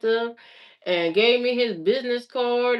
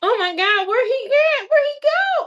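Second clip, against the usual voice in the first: frantic high cries, much higher and louder; panicked; 13 semitones higher, 4 dB louder than usual. Retold or imitated. Mother realizing her son vanished, panicked high calls. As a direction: call out in sudden panic for the lost child. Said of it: "panicked so bad".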